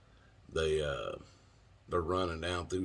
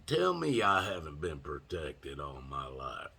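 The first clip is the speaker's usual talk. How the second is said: normal talking voice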